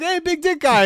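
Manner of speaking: very high, loud put-on voice; mock barista call-out. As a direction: squeal the nickname like a goofy barista. Imagined barista calling nickname.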